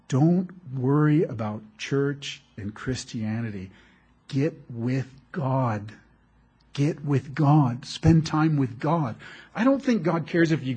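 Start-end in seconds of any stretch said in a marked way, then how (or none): 0.1-9.2 slow, spaced-out emphasis on each word; emphatic. Retold or imitated. Pastor urging a young woman about God, slow and emphatic. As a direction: press each word home slowly. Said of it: "I kept emphasizing to her".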